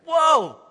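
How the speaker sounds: high excited whoa; a high, excited exclamation. Retold or imitated. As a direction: cheer it out like a thrilled fan. Peter praised by Jesus.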